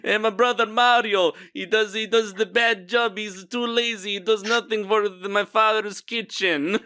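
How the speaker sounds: put-on high, hammy Italian accent; bad Italian accent. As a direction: complain like a cartoon Italian. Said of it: "just doing bad Italian accents".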